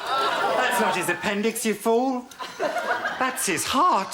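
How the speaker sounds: hammed-up outraged surgeon; mock angry scolding. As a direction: scold the assistant theatrically.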